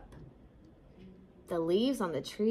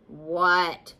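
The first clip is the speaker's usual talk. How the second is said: a touch of grumpy bear; growled; 3 semitones lower, 4 dB louder than usual. A narrator's reading. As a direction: lightly growl it, just woken. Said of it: "growled Bear".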